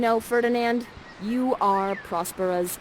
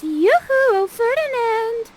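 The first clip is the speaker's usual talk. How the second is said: much higher, slow sing-song flirting; flirty call; 8 dB louder than usual. Acted. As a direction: coo at him to catch his eye. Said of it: "So Miranda's like this".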